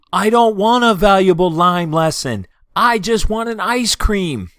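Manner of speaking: put-on a kid refusing a lesson, wanting ice cream; demanding exclamation. Shown as a demonstration. Teacher voicing a child's exclamation, loud whiny demand. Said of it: "they're expressing a feeling".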